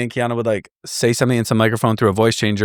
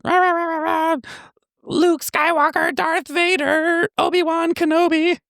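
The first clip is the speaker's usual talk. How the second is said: put-on voice-changer kid. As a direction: drag out the names in a squeaky kid voice. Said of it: "he's like".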